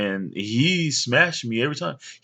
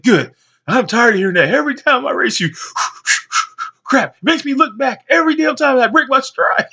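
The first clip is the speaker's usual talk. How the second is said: high, loud, exasperated complaint; annoyed rival; 12 semitones higher, 6 dB louder than usual. Retold or imitated. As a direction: vent your frustration at him, winded. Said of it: "He walks away mad".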